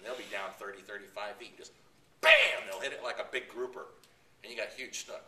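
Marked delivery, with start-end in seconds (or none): 2.2-2.6 loud, high burst; sudden bam. Shown as a demonstration. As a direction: punch out the strike. Fish striking the lure.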